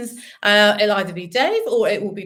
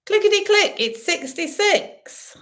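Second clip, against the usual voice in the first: bingo caller; 10 semitones higher, 3 dB louder than usual. Acted. Bingo calling numbers.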